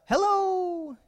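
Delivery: high, thinner echoed call; long high hello, held back in volume. Retold or imitated. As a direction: answer like an echo in the woods. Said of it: "he heard a".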